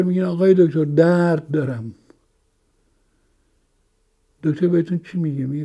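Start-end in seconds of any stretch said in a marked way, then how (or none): 0.2-1.9 drawn-out complaint. Shown as a demonstration.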